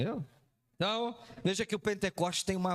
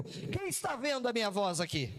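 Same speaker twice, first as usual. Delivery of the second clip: ordinary talk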